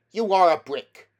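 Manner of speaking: loud, high and grateful; grateful exclamation. A narrator's reading. Diggory thanking Polly in the chaos, loud grateful cry. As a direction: praise her in a rush.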